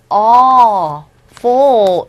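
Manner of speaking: "awful" slowly, in two long syllables; drawn-out pronunciation demo. Shown as a demonstration. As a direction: stretch "awful" out as AW, then FUL. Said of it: "이렇게 발음되죠".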